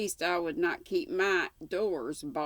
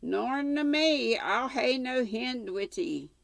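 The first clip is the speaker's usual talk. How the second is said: dialect servant; 8 semitones higher, 4 dB louder than usual. Acted. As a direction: fling it back rudely from the window. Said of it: "muttered the head".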